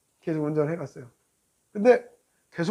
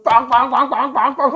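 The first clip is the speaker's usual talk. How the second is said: rapid-fire car horn blasts done by voice; frantic honking imitation; 3 semitones higher than usual. Driver mimicking the motorbike's horn behind him, frantic honking. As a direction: honk like a furious horn. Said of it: "미친 듯이 막 클락션을 울리는".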